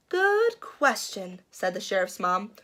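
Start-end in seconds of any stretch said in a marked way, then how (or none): 0.1-1.4 slow musing. Acted. Mom slowly musing.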